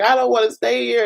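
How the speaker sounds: high, pleading cry; distressed plea. Retold or imitated. Calling the hotline from Atlanta, high distressed plea. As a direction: plead to get out of there. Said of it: "I was just like".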